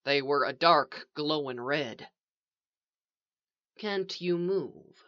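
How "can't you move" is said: soft, slow, courteous question; polite. A narrator's reading. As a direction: ask gently, with measured courtesy. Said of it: "the voice asked politely".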